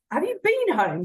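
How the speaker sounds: lightly mock the daughter who stayed; joking dad. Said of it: "Sort of joking".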